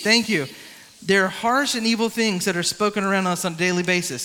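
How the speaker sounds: ordinary talk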